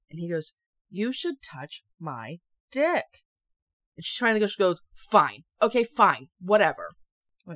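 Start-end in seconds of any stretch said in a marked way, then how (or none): 0.9-3.2 slow, word-by-word insistence; mock-stubborn slow repeat; insistent demand. Insistent boyfriend in read story. 5.1-6.9 loud, fed-up surrender; acted exasperated outburst; exasperated giving in. Worn-down girlfriend gives in.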